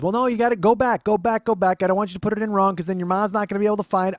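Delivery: high, loud, insistent aunt imitation; loud nagging. Aunt demanding nephew fix a typo, loud nagging. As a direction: nag urgently as the hovering aunt.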